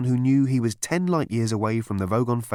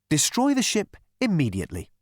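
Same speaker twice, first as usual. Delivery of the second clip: much higher, sing-song soothing voice; chirpy psychiatrist; 5 semitones higher than usual. A narrator's reading. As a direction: purr reassurance to a violent patient.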